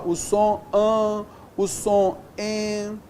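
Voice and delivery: "an" and "en" held long on a level note; nasal vowel sounds. Shown as a demonstration. Teacher showing digraph sounds, "an" and "en" slow and held. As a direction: draw out "an" and "en" as pure nasal vowels.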